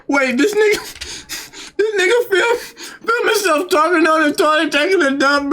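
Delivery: high laughing disbelief. Real, not acted. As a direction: laugh through the words in disbelief.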